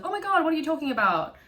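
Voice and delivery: high, fast and flustered; flustered denial. Retold or imitated. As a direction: squeak it out fast, caught off guard. Martin's usual flustered denial.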